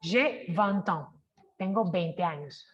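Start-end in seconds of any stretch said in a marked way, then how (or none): 0.0-1.1 J'ai vingt ans slowly, the T joined to ans; slow liaison. Shown as a demonstration. Teacher repeating the example slowly. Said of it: "esa T le da el sonido a la A".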